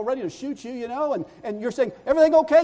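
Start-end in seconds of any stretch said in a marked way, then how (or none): 2.1-2.6 high, loud friendly check; calling out. Acted. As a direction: call up to him again. Acted passer-by calling out.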